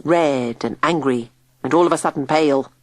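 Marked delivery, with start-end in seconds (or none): none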